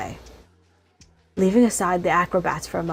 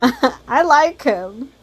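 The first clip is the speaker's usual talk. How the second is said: laughing, louder and higher, slow; 8 semitones higher, 5 dB louder, slower than usual. Real, not acted. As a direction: gush through giggles. Player delighted by NPC.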